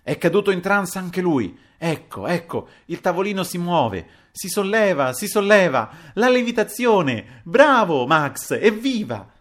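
high-pitched exultation, building to loud cheers; thrilled, high and loud cheering; excited shouting. A narrator's reading. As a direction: build from eager commentary to jubilant shouts. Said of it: "gridò il signor Anselmo".

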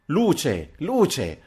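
high, urgent call for light; high startled call, narrated; alarmed cry. A narrator's reading. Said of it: "cacciò uno strillo acutissimo".

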